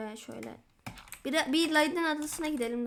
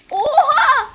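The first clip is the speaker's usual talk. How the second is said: loud, high shocked yelp with a laugh; startled shout; 12 semitones higher, 16 dB louder than usual. Real, not acted. Gamer hit out of nowhere, startled laughing yelp. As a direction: jump at the surprise.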